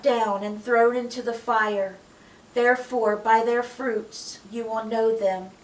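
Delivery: slow, loud solemn Bible reading; scripture reading. Acted. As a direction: proclaim it slowly and loud. Reads Jesus's words aloud.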